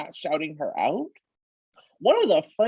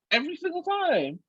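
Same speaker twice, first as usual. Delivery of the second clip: exasperated; 11 semitones higher, 3 dB quieter than usual. Real, not acted. Exasperated complaint.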